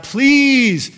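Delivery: high, loud cry of "Please"; Paul pleading with God to remove the thorn. Retold or imitated. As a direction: beg God with one high plea. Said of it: "he asked God".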